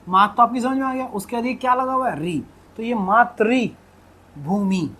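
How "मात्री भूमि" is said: slow "maat-rii, bhoomi" for मातृ भूमि; correct pronunciation. Shown as a demonstration. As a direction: break मातृ भूमि apart, pause before भूमि. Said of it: "ऐसे पढ़ा जाता है".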